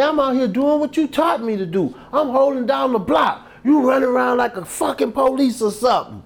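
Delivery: high, loud tirade; acted heated accusation; angry rebuke, re-enacted. Associate's angry rebuke, retold.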